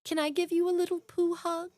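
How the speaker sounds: high, gentle cartoon-bear voice; high-pitched impression of a kindly bear; Winnie the Pooh. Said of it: "As Pooh".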